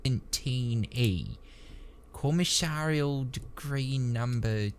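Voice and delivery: slow, halting syllables; effortful reading. A narrator's reading. Trooper sounding out the edict on the slate, laborious. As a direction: drag haltingly through unfamiliar official words. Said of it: "his face screwed up with effort".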